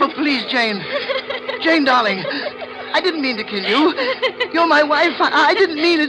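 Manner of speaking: very high, loud, sobbing breaks; terrified pleading. Acted. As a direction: beg the ghost for mercy, falling apart. Begs murdered wife's ghost.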